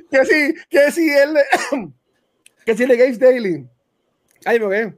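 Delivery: choking, coughing mid-sentence; something caught in the back of the throat. Real, not acted. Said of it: "me dio en la parte de atrás de la garganta".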